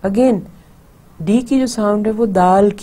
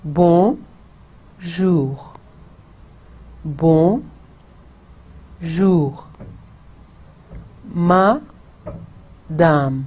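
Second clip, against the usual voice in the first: drawn out Bonjour and madame; slowly repeated; much slower than usual. Shown as a demonstration. Teacher models the words slowly for repeating. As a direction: stretch each word for repeating. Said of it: "इसको पहले slowly repeat करें".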